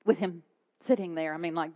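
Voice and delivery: ordinary talk, nothing marked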